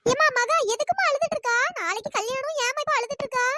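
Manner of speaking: high-pitched, questioning elder voice; pitched well up, fussing over her; concerned elder woman. Acted. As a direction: ask the weeping bride in a high voice. Elder questions the weeping bride.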